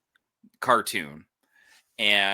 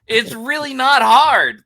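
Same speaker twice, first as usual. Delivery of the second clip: exasperated; 13 semitones higher, 7 dB louder, slower than usual. Real, not acted. Exasperated about simple plot fix.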